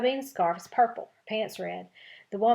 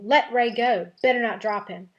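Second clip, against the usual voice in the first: yelling; 3 semitones higher, 8 dB louder than usual. Acted. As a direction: shout them down to save your brother.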